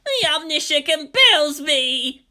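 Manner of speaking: slow, high zealous shout; distant shout. Acted. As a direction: proclaim it slowly like a fanatic. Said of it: "came a familiar distant shout".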